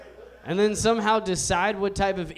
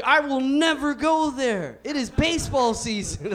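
adamant mock refusal; 7 semitones higher than usual. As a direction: refuse grandly, stretching the words out. Acted refusal to visit Navy Yard.

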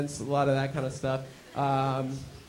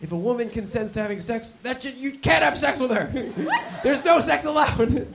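high-pitched mock activist voice, getting louder; zealous mom lecture; 7 semitones higher, 4 dB louder than usual. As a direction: preach about her son with absurd conviction. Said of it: "She's like".

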